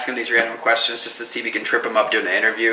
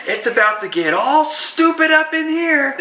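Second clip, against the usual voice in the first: much higher, slow mock outburst; Michael losing it; slower than usual. Husband imitating Michael losing it at the kid. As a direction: ham up a boss snapping. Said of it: "Michael, he just loses it".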